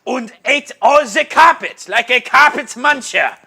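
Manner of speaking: high, loud mock German accent; hammed-up German punchline. Co-host finishes joke about Larry, loud mock German accent.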